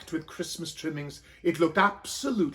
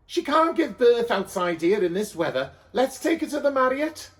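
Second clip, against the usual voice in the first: higher, louder, taking charge; decide quickly; urgent donkey man; 5 semitones higher, 4 dB louder than usual. A narrator's reading. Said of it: "said Donkey Man".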